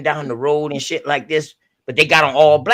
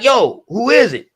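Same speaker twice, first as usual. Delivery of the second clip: louder, slower call through the door; slow raised call of who is it; 9 semitones higher, 5 dB louder, slower than usual. Retold or imitated. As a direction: call out to the knock, drawn out. Calling through door again.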